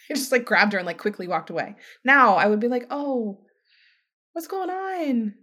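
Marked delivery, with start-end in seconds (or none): 2.9-5.3 softer, higher, slow caring question; gentle concern. Acted. Responding to someone crying in the store, gentle concern. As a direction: ask softly and slowly, inviting an answer.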